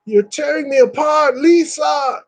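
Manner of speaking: high, shouted anguished movie line; high anguished shout, tearing me apart; Tommy Wiseau quote. Retold or imitated. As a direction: yell it like a tortured melodrama hero. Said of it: "he goes".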